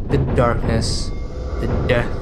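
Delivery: ordinary talk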